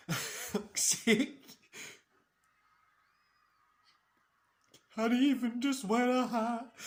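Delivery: high loud laughing rant; laughing disbelief. Real, not acted. Streamer laughs at the dad's question, loud and high. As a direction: laugh at how silly it is.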